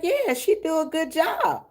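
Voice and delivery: high put-on voice of the funeral lady; funeral lady quoted. Aunt quoting the funeral lady to viewers, high put-on voice. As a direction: voice her high and brisk.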